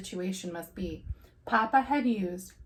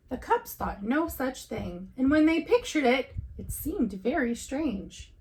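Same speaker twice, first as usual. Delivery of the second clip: ordinary delivery, nothing marked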